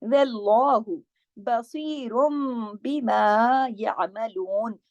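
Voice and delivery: very slow chanted verse, heavy lam and swad; Quranic recitation. Shown as a demonstration. Teacher reciting the full verse for students. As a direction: intone it like prayer. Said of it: "स्वाद जो है हर हाल में मोटा".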